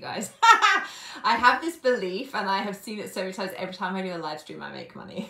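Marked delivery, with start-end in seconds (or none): 0.3-1.2 loud high burst of delighted laughter; sudden giggling outburst at a payment ping; big laugh. Real, not acted. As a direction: let a real laugh burst out. Burst of laughter mid-livestream.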